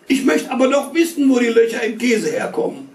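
put-on high, whiny little boy pestering; whiny boy's voice. A narrator's reading. Little boy whining to his mother at dinner. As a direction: whine it up high like a nagging child.

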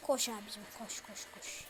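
hushed breathless koş, urging himself on; scared whisper to run. Real, not acted. Gamer whispering to himself to flee, hushed and urgent.

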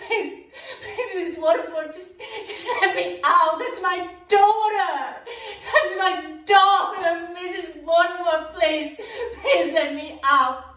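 desperate begging through sobs; tearful pleading with Mrs. Waterford; sobbing plea. Acted. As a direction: beg for your life, weeping. Handmaid begging to be let out.

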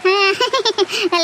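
delighted chuckle after a song; appreciative laugh. Acted. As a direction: laugh with approval.

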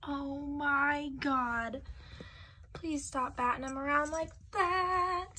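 real live pleading with the cat; disgusted pleading. Real, not acted. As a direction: beg the cat, grossed out.

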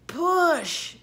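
high, loud effortful yell; forceful drawn-out command; urgent shout. A narrator's reading. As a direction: shout it while straining. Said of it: "bubbled Charles".

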